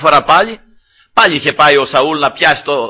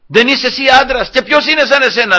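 raised, high-pitched challenge; calling out; 7 semitones higher than usual. Retold or imitated. David taunts the general from the mountain, calling out. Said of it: "Άρχισε να φωνάζει το στρατηγό".

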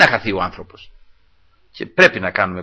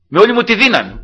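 raised, high preaching voice; retold shouting; 10 semitones higher, 5 dB louder than usual. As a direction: re-enact shouting with all your strength. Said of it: "φώναξα δυνατά". (δυνατή φωνή, σαν να φωνάζει)

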